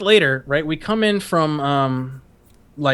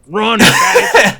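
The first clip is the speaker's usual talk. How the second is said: slow, louder jeer, higher pitched; mock jeering yell; 5 semitones higher, 8 dB louder, much slower than usual. Recruits jeering at the heavy new guy, slow mock yell. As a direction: drag it out like a bully taunting the fat kid. Mocking recruits yelling at him.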